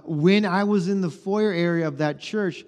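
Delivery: ordinary talk, nothing marked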